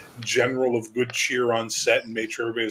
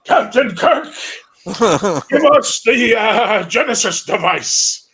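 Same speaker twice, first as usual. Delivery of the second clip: high, loud, slow put-on villain; Klingon villain voice; 11 semitones higher, 9 dB louder, much slower than usual. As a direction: drag out a hammy alien demand. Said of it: "Christopher Lloyd is a Klingon".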